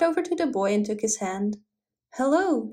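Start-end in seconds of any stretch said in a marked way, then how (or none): none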